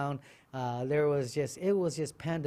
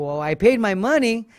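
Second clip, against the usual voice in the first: indignant protest; 7 semitones higher, 11 dB louder than usual. Retold or imitated. Retold protest at registration.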